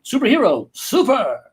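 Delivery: hammed-up superhero announcement. Dad showing off the mug to his kid, superhero. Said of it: "I did this the other day".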